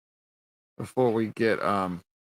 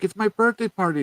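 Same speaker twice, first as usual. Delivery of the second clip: put-on whiny kid voice; 11 semitones higher, 4 dB louder than usual. Kids voiced in retelling.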